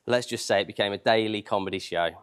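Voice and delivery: ordinary delivery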